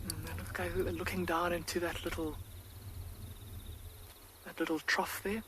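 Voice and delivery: very hushed, near-whispered commentary; soft wildlife-watching whisper. Real, not acted. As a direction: keep it barely audible, close to the animals. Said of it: "lower my voice a little bit".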